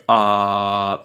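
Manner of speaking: "ah" held long and steady; one long, level "ah"; unmoving vowel. Shown as a demonstration. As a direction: sustain "ah" with no change of sound. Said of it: "it doesn't move".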